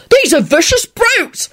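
high, slow yelp of outrage; indignant high-pitched constable. A narrator's reading. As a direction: play an alarmed constable yelping in protest. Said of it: "the constable yelped out".

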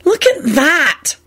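high, slow anxious voice; worried piping. A narrator's reading. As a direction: point it out anxiously, drawn out. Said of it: "Esther piped in worriedly".

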